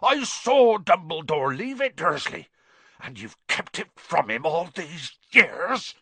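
outraged giant, high and building; trembling with rage. A narrator's reading. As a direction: accuse him with mounting fury. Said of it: "every syllable trembled with rage".

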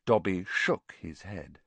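usual speaking voice

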